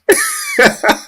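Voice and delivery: very high squeal of laughter. Real, not acted. Host watching Quadoc's face, squealing laugh.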